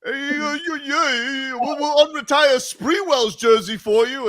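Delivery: high, put-on New York fan voice; mock New York fan. Re-doing the fan's voice for his co-hosts. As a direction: ham up the brash fan again. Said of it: "That's how he sounded".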